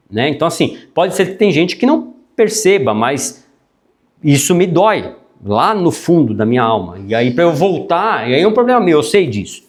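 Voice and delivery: ordinary delivery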